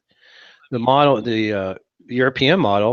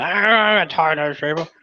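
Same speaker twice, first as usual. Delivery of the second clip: put-on drunk voice; 4 semitones higher than usual. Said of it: "you were drunk behind me going".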